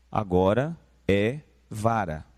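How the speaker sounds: usual speaking voice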